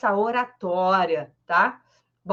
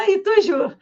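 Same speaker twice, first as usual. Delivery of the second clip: high, bright laugh in the words; laughing through it; 10 semitones higher, 6 dB louder than usual. Real, not acted. Teacher laughing at her own French pun, laughing lightly. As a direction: giggle out the pun.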